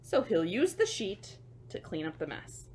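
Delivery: usual speaking voice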